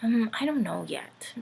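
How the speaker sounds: whispered, soft mumble; mumbled. Acted. Dorothy mumbling to her uncle, whispered. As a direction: mumble it down at your feet. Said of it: "Dorothy mumbled".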